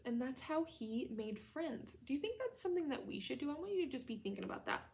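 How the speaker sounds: usual speaking voice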